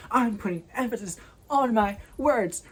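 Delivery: "I'm putting emphasis on my words" slow, loud and high, each chunk punched; mockingly over-stress "I'm putting emphasis on my words", drawing it out; exaggerated emphasis. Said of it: "put emphasis on whatever".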